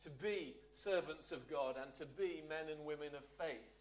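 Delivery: usual speaking voice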